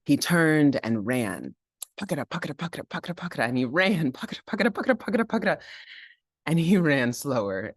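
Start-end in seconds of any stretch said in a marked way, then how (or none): 2.0-3.5 soft running rhythm. Acted. Running back. 4.1-5.6 high running rhythm. Acted. Running fast.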